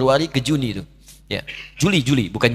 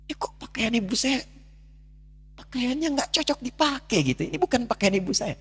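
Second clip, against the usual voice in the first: higher, hushed stunned cry; shocked disbelief; 12 semitones higher, 4 dB quieter than usual. Retold or imitated. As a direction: say it as if you cannot believe your eyes. Shock at mother's worn clothes.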